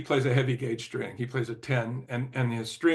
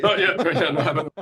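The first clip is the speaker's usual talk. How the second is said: real laugh, much higher and louder; laughing through words; 4 semitones higher, 7 dB louder than usual. Real, not acted. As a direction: laugh out the yes. Laughs answering host's question.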